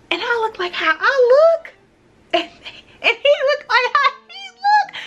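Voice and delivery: mock-offended high voice breaking into a laugh; outraged disbelief. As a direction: squeal it like it's ridiculous. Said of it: "And I'm sitting like".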